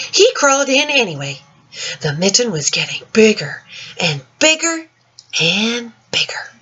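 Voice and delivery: put-on gentle, lilting read-aloud for children; story reading. Teacher reading The Mitten to children, lilting storytime voice. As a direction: read it like storytime to little ones. Reads picture book aloud.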